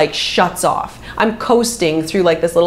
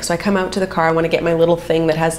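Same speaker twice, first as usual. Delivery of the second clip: ordinary delivery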